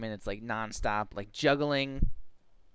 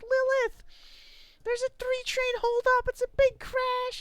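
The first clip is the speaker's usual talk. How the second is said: hammed-up, high and louder crash alert; mock alarm; 3 dB louder than usual. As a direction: announce a toy disaster in a squeal. Play-acting crash alarm to child.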